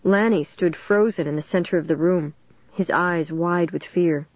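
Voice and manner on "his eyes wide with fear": ordinary delivery, nothing marked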